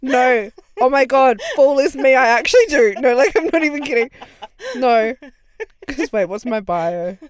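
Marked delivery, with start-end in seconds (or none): 0.0-5.1 excited. Real, not acted. Realizing own bio, loud.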